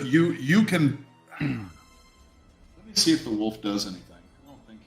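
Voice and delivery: ordinary talk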